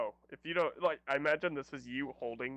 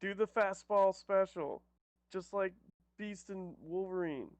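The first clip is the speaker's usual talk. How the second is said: higher, slow, stretched-out command; heroic battle call; 4 semitones higher, slower than usual. Acted. Player calls a team attack to a friend, heroic battle call. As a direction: call the move like a cartoon hero.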